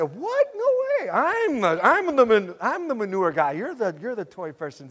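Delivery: high, loud mock protest of disbelief; indignant protest. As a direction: protest to wife, playfully outraged. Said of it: "I said".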